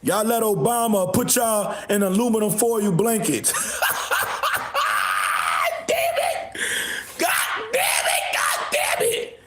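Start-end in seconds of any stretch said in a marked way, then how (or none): 3.6-5.8 low wheezy laugh; hoarse breathy laughter. Real, not acted. Laughing at his own joke. 5.9-9.4 high squealed damn it through laughter; breathless laughing cries. Real, not acted. Laughing exclamations.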